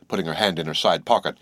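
ordinary delivery

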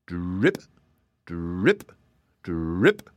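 low drip that flicks up at the end; dripping sound effect. A narrator's reading. Storyteller voicing butter melting off a hat, low dripping. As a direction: imitate slow, separate drips of melting butter.

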